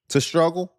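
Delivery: "struggle" punched high and loud; stress on struggle. Real, not acted. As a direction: hit "struggle" hard and high. Emphatic point.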